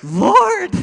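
sudden high loud cry of joy; exclaiming Lord. Retold or imitated. Thrilled she wants to date him, crying out to God. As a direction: burst out with a loud high shout. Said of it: "And so I was like".

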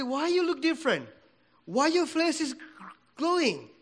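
usual speaking voice

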